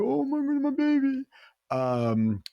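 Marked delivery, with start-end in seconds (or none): 0.0-1.2 very high-pitched mock parental alarm; mock distressed wail. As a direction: wail over a hurt child, hammed up. Acting out upset over hurt child.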